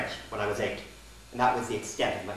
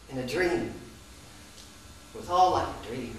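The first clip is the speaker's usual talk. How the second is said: ordinary talk, nothing marked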